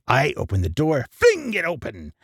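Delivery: ordinary delivery, nothing marked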